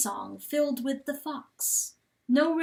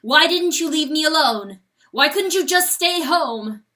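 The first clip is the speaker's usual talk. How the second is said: higher, much louder accusing questions; angry demand; 6 semitones higher, 10 dB louder than usual. A narrator's reading. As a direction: hammer each question at her. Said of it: "drove the question into her like a nail".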